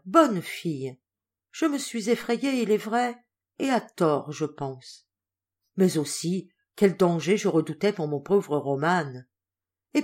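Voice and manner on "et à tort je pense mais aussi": plain reading voice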